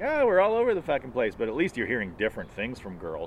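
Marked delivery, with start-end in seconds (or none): 0.0-1.3 high, bright mock woman's voice; mock rambling woman. Mocking how women ramble, high and loud. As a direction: squeak it out like a scatterbrained woman. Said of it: "women are like".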